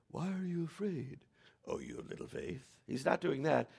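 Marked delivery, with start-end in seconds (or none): 0.1-2.7 soft, hushed mock reproach; mild, gentle rebuke. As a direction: play it far too quietly for a storm. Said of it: "it's not like he's going".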